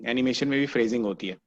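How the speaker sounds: ordinary delivery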